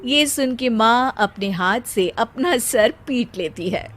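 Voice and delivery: ordinary delivery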